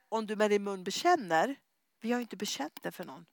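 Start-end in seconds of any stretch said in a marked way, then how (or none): none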